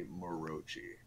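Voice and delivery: "Morochi" as mo-ROH-chee, three syllables; caster's mispronunciation. Retold or imitated. Guest retelling a caster's blunder, mistaken pronunciation. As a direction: say "Morochi" with a ch replacing the k. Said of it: "had an N G S caster call me".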